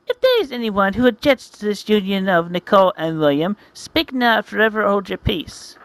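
hammed-up formal officiant; wedding officiant.

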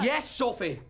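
high, forceful overruling; shouted insistence. Acted. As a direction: cut off your child's refusal sharply. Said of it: "I didn't mean to shout".

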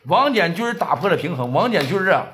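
ordinary delivery

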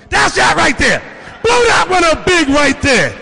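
calling out. Real, not acted. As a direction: shout it across the room. Calling to screen operator.

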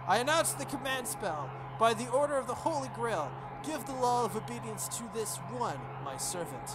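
high, formal ritual declaration; solemn invocation. Acted. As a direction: declare it like a sacred rite. Rin formally invokes command spell.

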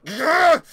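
loud, high cry of fright; sudden high, loud "ugh"; startled yelp. Real, not acted. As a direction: yelp in disgusted alarm. Startled by the game.

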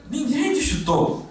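very high, drawn-out retort; high-pitched mock protest; acted denial. Acted reply to injured player.

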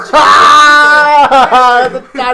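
explosive, very loud belly laugh; real shrieking laugh; laughter. Real, not acted. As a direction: burst out in high, helpless laughter. Cracks up at odd phrase.

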